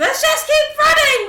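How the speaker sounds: very high full yell; yelled urging. Acted. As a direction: yell it over the other's protest. Repeats the order, escalating.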